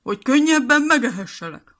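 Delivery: the wolf roars at Piroska before he leaps; wolf's roar. Acted. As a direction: bellow it as the wolf pounces. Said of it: "ordította erre a farkas".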